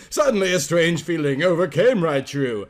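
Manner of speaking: plain reading voice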